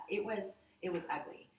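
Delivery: usual speaking voice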